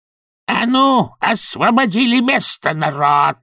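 high, drawn-out bellow; big commanding holler; roaring command. A narrator's reading. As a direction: roar at the crowd to make room. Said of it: "взревел архиканцлер".

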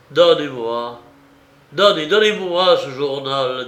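loud, halting plea, voice breaking; broken pleading. Acted. Aratov on his knees begging Anna, loud broken plea. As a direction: beg for the diary in a loud broken voice. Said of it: "d'une voix entrecoupée".

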